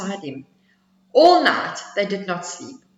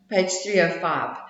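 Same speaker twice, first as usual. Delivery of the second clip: usual speaking voice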